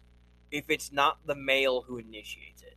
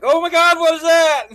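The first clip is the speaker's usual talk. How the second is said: high, loud mock shriek; startled shriek; 13 semitones higher, 13 dB louder than usual. Guy hit by a stink beetle mid-sex, high loud mock shriek. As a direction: shriek in fake alarm.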